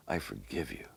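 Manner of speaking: "I forgive you" spoken low and hushed but voiced; quiet voiced "I forgive you"; gentle forgiveness. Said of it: "Just as if Jesus was holding".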